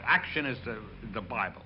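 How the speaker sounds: ordinary talk, nothing marked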